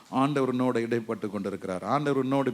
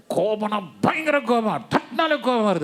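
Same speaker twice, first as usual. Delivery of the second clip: higher, louder angry outburst; mock anger; 8 semitones higher, 4 dB louder than usual. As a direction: act out the anger. Mimicking society's anger.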